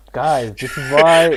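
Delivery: put-on loud, drawn-out go at a Canadian accent; poorly imitated Canadian accent; attempted Canadian accent. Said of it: "I can't replicate his".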